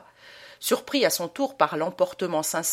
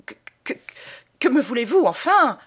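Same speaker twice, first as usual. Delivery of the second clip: stuttering, rising to a shrill high cry; stammering; 7 semitones higher, 7 dB louder than usual. A narrator's reading. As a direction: stammer in panic, then burst out. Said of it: "il bégaya". (bégaiement affolé qui monte en cri)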